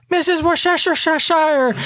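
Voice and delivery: put-on high child voice tripping over "Worcestershire"; kid's garbled name. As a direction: stumble through extra syllables in "Worcestershire" like a small kid. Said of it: "The kids can't say her full name correctly".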